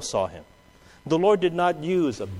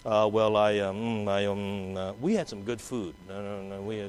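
sheepish mumble; 7 semitones lower, 7 dB quieter than usual. Acted. Hesitant believer hiding faith.

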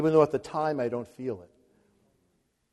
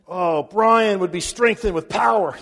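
higher, louder mock of his wife praying his name in; imitating wife praying; 4 semitones higher, 7 dB louder than usual. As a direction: lightly voice your wife's personal prayer. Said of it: "She always personalizes it".

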